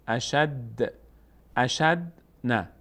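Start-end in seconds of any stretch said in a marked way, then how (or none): none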